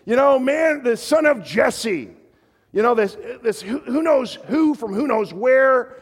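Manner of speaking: high, loud scornful sneer; mocking, loud disdain at a stranger; contemptuous Nabal. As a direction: spit out the scorn of a rich churl. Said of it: "He's like".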